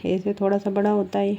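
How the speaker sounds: ordinary talk, nothing marked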